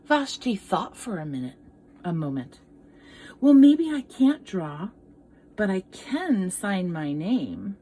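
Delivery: gentle read-aloud storytelling; story narration. Acted. Teacher reading a picture book to children, storytelling voice. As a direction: tell it like a picture book to kids.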